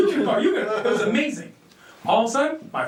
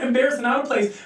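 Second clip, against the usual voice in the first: ordinary talk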